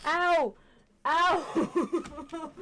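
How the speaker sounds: loud, high pained yelps; sharp ouch. Real, not acted. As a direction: yelp in real pain from a cramp. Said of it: "I got a cramp in my foot".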